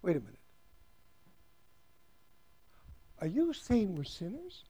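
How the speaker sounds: hushed, taken-aback questioner; doubting listener. Pretend. Preacher voicing a listener offended at being called a sinner, hushed. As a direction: ask it quietly, half offended. Voiced listener objects again.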